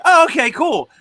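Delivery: high, bright-eyed okay cool; sudden high excited agreement; eager acceptance. Retold or imitated. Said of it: "I was like".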